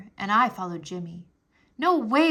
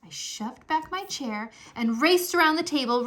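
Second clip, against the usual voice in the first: ordinary delivery, nothing marked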